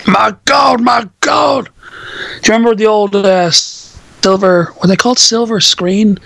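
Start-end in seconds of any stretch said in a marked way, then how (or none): 0.1-1.7 high, shouted commentator impression; excited wrestling commentator. Recalling old wrestling promos, hammed-up commentator shout. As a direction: cry it out like a shocked ringside announcer.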